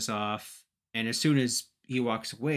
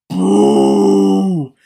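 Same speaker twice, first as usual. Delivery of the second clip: long, loud booing; crowd boo; 6 semitones higher, 14 dB louder than usual. Retold or imitated. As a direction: boo like a hostile arena crowd.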